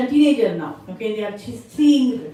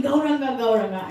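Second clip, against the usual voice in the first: usual speaking voice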